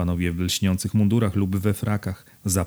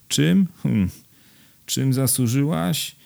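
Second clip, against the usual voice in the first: higher questioning tone, with a pondering hum; mildly puzzled echo; 6 semitones higher, slower than usual. A narrator's reading. Husband echoes his bride's question, mildly puzzled. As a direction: echo the question back, pitched up, then slow down.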